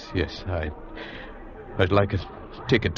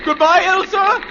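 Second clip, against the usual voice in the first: loud, high goodbye called across flames; final goodbye shouted to the lost lover; shouted farewell; 5 dB louder, slower than usual. Acted. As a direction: call it out as you let her go.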